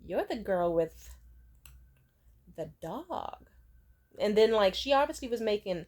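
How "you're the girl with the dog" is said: slow, sour put-down, trailing off; a lightly voiced sour old lady sneering; tart and rude. Rude old office lady sneering at the girl with the dog. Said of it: "very tart, rude, sour old lady".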